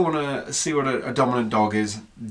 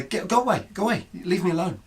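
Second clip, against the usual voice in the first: retold frustration; 6 semitones higher than usual. Owner brushing off her fussing dog, retold frustration. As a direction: shoo someone away with retold irritation. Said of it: "I would get frustrated with her".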